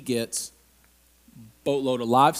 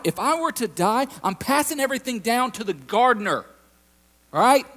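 higher, louder, whiny complaint to God; pouty Abraham; 6 semitones higher, 4 dB louder than usual. Retold or imitated. Abraham complaining to God, pouty and whiny. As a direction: sulk at God like a child let down. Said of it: "Abraham getting a little bit pouty with God".

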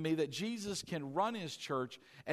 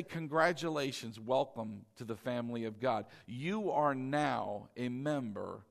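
warm welcome; 6 semitones lower than usual. Shown as a demonstration. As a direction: greet them gently into the family. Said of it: "We say".

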